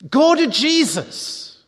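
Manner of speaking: slow, high, ringing call to go to Jesus; impassioned preacher's cry; fervent appeal. Real, not acted. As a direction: lift the voice and plead with the congregation.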